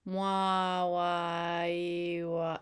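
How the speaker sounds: muffled, slowed-down wailing sounds; drawn-out muffled ghostly wah sounds. Shown as a demonstration. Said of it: "it sounds like this".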